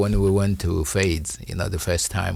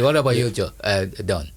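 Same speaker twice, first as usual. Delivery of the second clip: ordinary delivery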